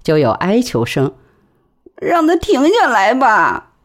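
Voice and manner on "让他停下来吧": high, slow pleading plea. A narrator's reading. Frightened woman begs the men to stop. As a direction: beg them to stop the horse.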